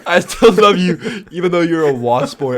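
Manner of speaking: loud, laughing imitation of a retold line; laughing, exaggerated retelling; laughing mock quote.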